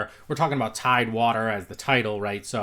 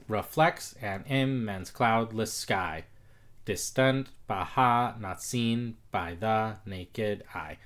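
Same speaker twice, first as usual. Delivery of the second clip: slow rhythmic reading; slower than usual. Shown as a demonstration. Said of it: "that sounds a little clunky".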